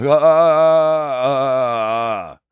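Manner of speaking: panicked gasps on waking; startled awake, gasping for breath. Retold or imitated. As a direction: gasp for air, loud and high.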